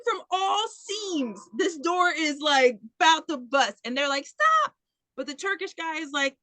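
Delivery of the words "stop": high yelled stop; hammed-up cry to stop; mock yell. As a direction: call it out as a mock shout. Crowd yelling at father.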